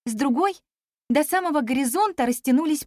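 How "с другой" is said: plain reading voice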